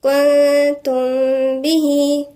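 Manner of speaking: كنتم به slowly, the nun hidden in a held nasal hum; ikhfa ghunna. Shown as a demonstration. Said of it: "يبقى هنا في غنة إخفاء".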